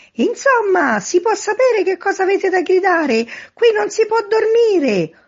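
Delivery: very high, loud outraged yell. A narrator's reading. Sleepless first-floor neighbour to noisy men, yelling in protest. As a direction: yell at them for keeping you awake. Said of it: "urlò un signore affacciandosi a una finestra".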